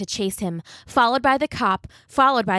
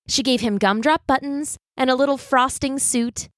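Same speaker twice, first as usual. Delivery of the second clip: plain reading voice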